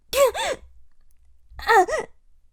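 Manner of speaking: high, loud laugh that catches on the hurt; pained laugh. Acted. Wounded padawan to her host, a loud pained laugh at her weakness. Laugh as she fails to stand.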